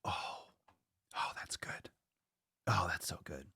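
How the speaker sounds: savoring whisper. Real, not acted. Tasting broth, savoring.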